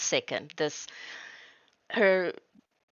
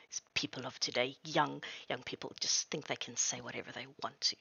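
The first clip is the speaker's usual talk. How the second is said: much softer, fast, lower grumble; grumbling mutter; 5 semitones lower, 9 dB quieter, faster than usual. Acted. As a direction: mumble to herself, half whispered. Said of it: "starts mumbling to herself".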